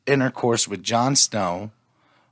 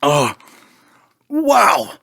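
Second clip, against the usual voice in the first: eating sour candy; 5 semitones higher, much slower than usual. Real, not acted. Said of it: "My tongue is numb".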